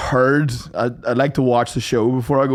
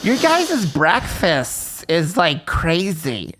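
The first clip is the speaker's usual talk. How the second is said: put-on drawling American girl; 3 semitones higher, much slower than usual. Arizona girl voiced, slow.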